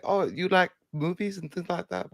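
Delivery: mock chatty worker. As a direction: play a nosy small-talker, lightly. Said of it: "I know it's gonna go like".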